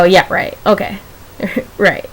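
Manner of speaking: low, skeptical brush-off with a chuckle; sure-you-are tone, laughing it off; dismissive disbelief. Retold or imitated. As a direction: scoff lightly at an unlikely promise. Imagined disbelieving reply to herself.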